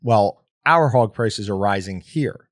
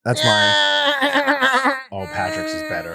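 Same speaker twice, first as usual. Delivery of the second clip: goat voice; much slower than usual. Acted. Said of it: "a goat crying voice".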